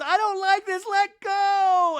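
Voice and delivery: high, loud panicked squeal; hammed-up terrified imitation; mock panic. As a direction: cry out in exaggerated fright. Imitates Brittany panicking in woods.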